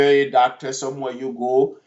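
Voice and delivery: ordinary talk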